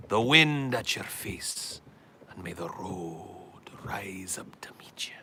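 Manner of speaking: slow, drawn-out benediction; mock-solemn blessing. Blessing offered to Brother Paul, slowly intoned. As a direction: intone it with stretched words, fading at the close.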